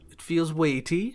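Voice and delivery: a hint of a hesitant hobbit lady; polite lady character.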